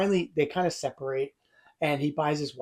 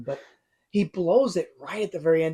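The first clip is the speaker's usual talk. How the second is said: ordinary talk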